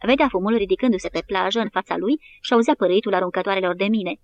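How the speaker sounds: usual speaking voice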